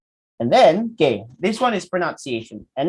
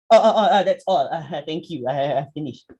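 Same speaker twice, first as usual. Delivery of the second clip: awkward mock ending. Acting a student who can't end the presentation, awkward and stammering. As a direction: stumble out of the speech with an embarrassed laugh. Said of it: "People find it difficult to finish".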